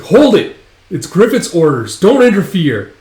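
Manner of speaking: high, loud order; acted urgent command. Bandmate holds the others back from the duel, urgent shout. Retold order to stop the band.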